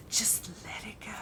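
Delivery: whispered grinning "Just let it go"; soft whisper with a grin; dad with a grin. Retold or imitated. Father grinning at her, soft whisper. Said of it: "he's like".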